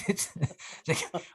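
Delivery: normal talking voice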